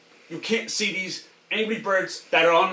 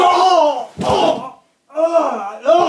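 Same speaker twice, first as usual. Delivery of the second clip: high, loud yelped oh; pained cries; 5 semitones higher, 4 dB louder, much slower than usual. Pretend. Enrique caught out by Anthony's prank, pained cries. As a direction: yelp out oh after oh.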